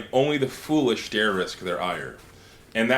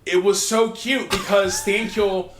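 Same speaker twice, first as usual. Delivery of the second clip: gushing; 8 semitones higher, 3 dB louder, slower than usual. Real, not acted. Gushing over cute scene.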